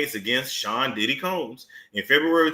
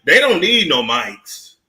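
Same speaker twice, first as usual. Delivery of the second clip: usual speaking voice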